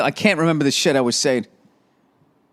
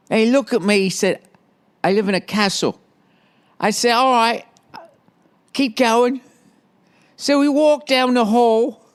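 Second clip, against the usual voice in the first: high-pitched, hammed-up English accent; 5 semitones higher than usual. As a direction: put on a silly high English voice. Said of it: "why she has an English accent".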